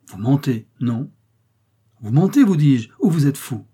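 mounting outraged disbelief; angry denial. Acted. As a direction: hurl the accusation at him.

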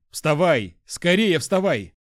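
loud, high pleading orders; urgent shouting. A narrator's reading. Lad rouses his fallen hyena, urgent shouting. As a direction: shout to get it up. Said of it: "крикнул Жюль".

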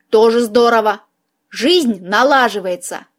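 plain reading voice

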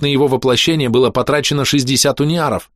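plain reading voice

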